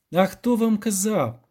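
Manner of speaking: high, drawn-out indignant challenge; angry. A narrator's reading. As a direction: throw the question back in anger. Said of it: "розсердився Любисток".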